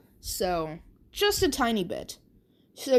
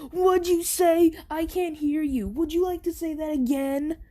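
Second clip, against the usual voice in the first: taunting bully voice; 7 semitones higher than usual. Acted. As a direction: dare him to repeat it, sneering. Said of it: "And Bakugo's like".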